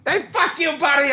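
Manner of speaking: high mock insult; Philly guy cussing. Philly local cussing out a tourist, mock angry. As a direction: bark it like a cranky local. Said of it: "Philly guy cussing out visitor".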